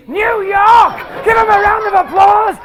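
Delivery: loud, high, delighted cheer; excited shout. Real, not acted. Host cheering the New York visitor to the crowd. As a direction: whip the crowd into applause.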